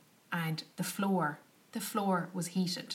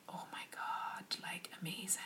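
softer whispered wonder; awed whisper; 11 dB quieter than usual. Retold or imitated. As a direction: hush it like a secret treat. Gifted luxury after rough sleeping.

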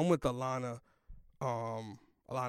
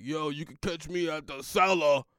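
loud, hammed-up brag; mock boasting; 7 dB louder than usual. Comic imagining himself basking in getting passed, loud mock boast. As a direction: call it out like a pretend big shot.

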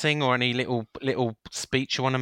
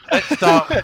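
put-on loud, higher Welsh Indian shopkeeper accent; 11 semitones higher, 8 dB louder than usual. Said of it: "the Welsh Indian shopkeeper from Swansea".

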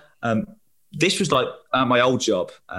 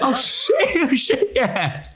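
surprised; 13 semitones higher, much slower than usual. Real, not acted. Forgot to ask co-host's beer.

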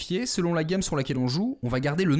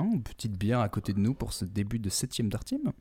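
normal talking voice

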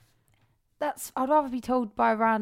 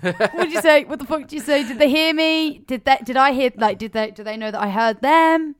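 mock panic; 5 semitones higher, 8 dB louder than usual. Panicked retelling after phone slip.

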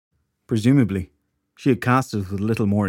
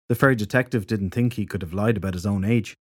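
usual speaking voice

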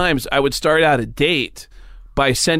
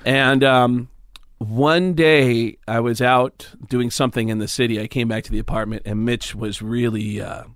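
ordinary talk

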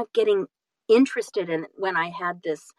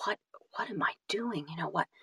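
hushed, worried whisper to herself; panicked whisper; 6 dB quieter than usual. Retold or imitated. Recalling panicked first-day thoughts.

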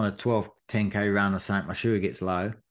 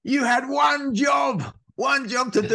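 mocking shout; slower than usual. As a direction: shout a joking scolding across the course. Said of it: "And I went".

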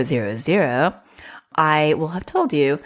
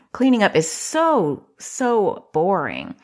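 mock sympathy; 3 semitones higher, slower than usual.

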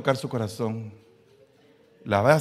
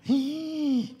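sharp high mock gasp; shocked gasp. Preacher reacting to a prayer for patience, mock gasp.

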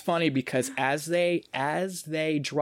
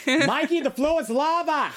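high, loud mock Boston holler; Boston accent; 13 semitones higher, 4 dB louder than usual. Host mimicking contestant warning Mikey about lava, Boston-accented shout. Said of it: "do lava in a Boston accent".

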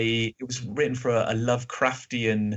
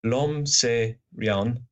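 put-on very slow, deliberate French accent; much slower than usual. Podcaster quoting Flaubert in a careful French accent. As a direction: pronounce it slowly in French. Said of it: "That's my French accent".

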